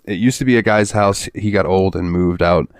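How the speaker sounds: ordinary talk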